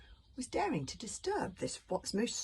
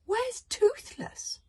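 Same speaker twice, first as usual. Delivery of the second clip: alarmed question; 6 dB louder, much slower than usual. A narrator's reading. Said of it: "he said".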